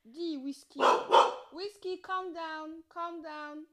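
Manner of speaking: slow, high soothing voice to a dog; calming a dog. Real, not acted. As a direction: slowly soothe the barking dog.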